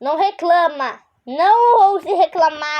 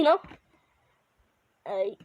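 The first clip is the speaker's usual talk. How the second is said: usual speaking voice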